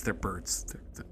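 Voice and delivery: softly whispered deadpan joke; whispered aside. Real, not acted. Player whispering a joke about the crows, hushed. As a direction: drop to a whisper for the punchline.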